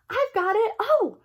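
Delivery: high, loud cry of discovery; girl pulling a toy from the box, excited high cry. A narrator's reading. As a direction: burst out with the find.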